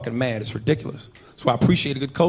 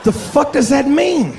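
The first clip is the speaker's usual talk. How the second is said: high, loud, drawn-out disbelief; loud, incredulous question; baffled outburst; 10 semitones higher, 6 dB louder, much slower than usual. Acted. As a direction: cry out slowly in exasperated confusion. Baffled reaction to the phrase.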